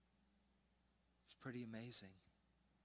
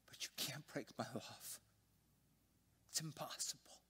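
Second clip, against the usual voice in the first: tender whisper; 4 semitones higher than usual. Retold or imitated. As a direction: breathe the words gently, full of love. Father's tender words to daughter.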